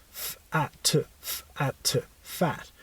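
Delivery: F-at- sounded out slowly, sound by sound, then blended into fat. Shown as a demonstration. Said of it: "then you end up with the word fat".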